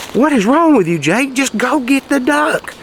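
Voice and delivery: bewildered owner snapping at his dog; frustrated scolding. Retold or imitated.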